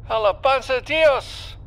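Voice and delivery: high-pitched cry of praise, shouted out; jubilant shout. Acted. Gunner shouts praise over the intercom after the hit, jubilant shout. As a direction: shout it high in triumph, in Spanish. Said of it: "Vicente shouted over the intercom".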